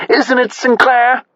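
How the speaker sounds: high, slow, gushing society matron; posh society lady. A narrator's reading. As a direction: gush like a rich socialite.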